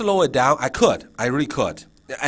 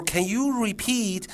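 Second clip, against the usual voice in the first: slow, higher, drawn-out "repeat"; teacher's prompt; 8 semitones higher, slower than usual. Shown as a demonstration. Teacher asking non-English-speaking kids to repeat, slow prompt. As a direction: spell out the request slowly for kids. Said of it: "So when you say".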